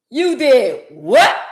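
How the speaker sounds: slow, loud, drawn-out "you did what"; outraged disbelief. Acted. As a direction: stretch it out in horror.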